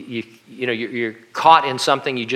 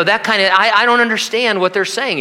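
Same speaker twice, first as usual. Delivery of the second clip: mock bewildered; 8 semitones higher than usual. Real, not acted. Mock confusion at British speech.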